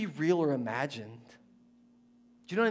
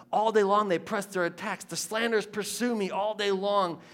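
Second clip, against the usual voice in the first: higher, louder complaining voice; complaining David; 7 semitones higher, 4 dB louder than usual. Retold or imitated. Preacher voicing David's complaint to God, aggrieved and raised. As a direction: voice David's grievance with rising heat. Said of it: "he starts to complain".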